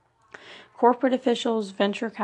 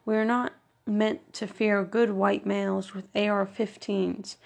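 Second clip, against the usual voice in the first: usual speaking voice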